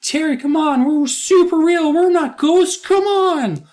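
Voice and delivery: squeaky-high, loud hammed-up pleading; mock pleading kids. Kids insisting to Terry they're real, mock pleading. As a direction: mimic kids begging at the top of the range. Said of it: "Louisa and Sam are just like".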